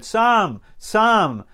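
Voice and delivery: high, loud call across to the neighbour; wife shouting for the neighbour out the window. Retold or imitated. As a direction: yell the name out into the night. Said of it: "stuck her head out and shouted".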